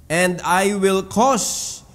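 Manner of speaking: slow, drawn-out reading of the verse; slow scripture reading. Acted. Preacher reading the verse to the church, slow and solemn. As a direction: lean on each word of the promise.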